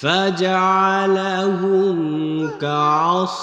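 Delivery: slow melodic chanted recitation; Quran recitation. Acted. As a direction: intone each word with a long held tune. Recites the verses.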